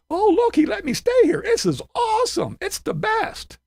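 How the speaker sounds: high, gushing mock glee; mocking the bonehead who loves a free room, high gushing voice; mock delighted Tim. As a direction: ham up a fake thrilled voice.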